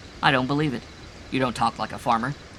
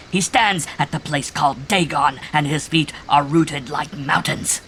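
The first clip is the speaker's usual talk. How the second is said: loud, higher, rapturous chant; crazy ecstasy; 5 semitones higher, 7 dB louder than usual. A narrator's reading. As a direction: proclaim it in mad religious rapture. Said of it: "a crazy ecstasy was in it".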